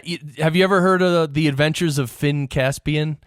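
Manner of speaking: normal talking voice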